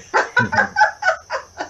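an airy high laugh; breathy high laugh. Real, not acted. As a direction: laugh lightly, almost silent. Laughing at own advice.

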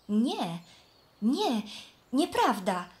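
cheerful denial. Acted. Flax answering the fence posts, cheerful denial. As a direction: laugh off the posts' warning cheerfully. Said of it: "odpowiedział Len wesoło".

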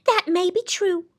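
stubborn reply pitched high. A narrator's reading. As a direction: concede it without giving way. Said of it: "she replied stubbornly".

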